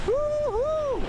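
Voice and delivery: high, loud whoop of delight; excited whoop. Real, not acted. Vlogger whooping on the windy hilltop, high and thrilled. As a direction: let out a thrilled yell into the wind.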